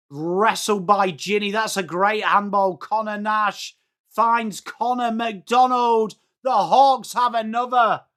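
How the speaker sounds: commentary building to an excited call; live goal call. Real, not acted. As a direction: build from steady play-by-play to a thrilled goal call.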